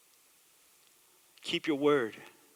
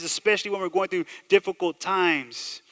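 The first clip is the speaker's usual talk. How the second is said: ordinary delivery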